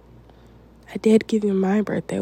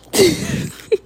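high, teasing giggle; 13 semitones higher, 3 dB louder than usual. Acted. Girlfriend teasing her partner, high giggle. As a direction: giggle at a naughty question.

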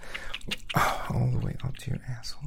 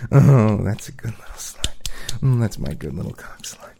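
whispering; 5 dB louder than usual. Acted. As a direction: hush it, intimate and teasing. Loud whisper praise.

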